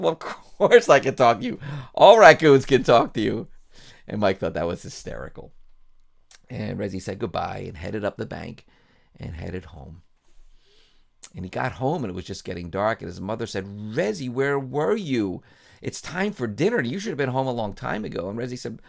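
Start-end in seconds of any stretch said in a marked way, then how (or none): 0.0-3.5 high and loud, laughing it off; bright raccoon laugh-line; amused retort. Acted. 13.7-18.3 higher, worried mom voice; mild mother's scolding. Acted. Mother scolds late son.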